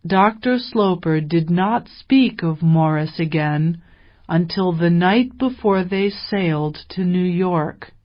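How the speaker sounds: usual speaking voice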